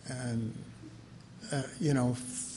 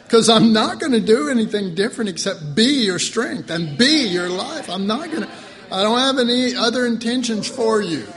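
louder, higher, emphatic promise; firm declaration; 6 semitones higher, 8 dB louder than usual. Acted. Preacher voicing Jesus, loud and emphatic. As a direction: declare it with full conviction.

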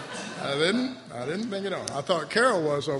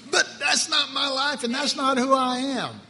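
high, raised preacher's voice; defiant declaration; 7 semitones higher, 4 dB louder than usual. Acted. Preacher models fessing up, then declares defiantly.

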